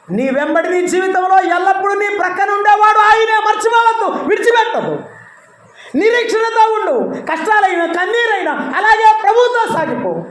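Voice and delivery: high, loud preaching; preaching at full force. Acted. Preacher exhorts to endure. (బిగ్గరగా, ఎత్తైన స్వరంతో ప్రసంగం)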